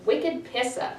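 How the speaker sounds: "Wicked Pissa" again, r dropped, even fall; Boston accent. Shown as a demonstration. Teacher repeating the Boston phrase for students.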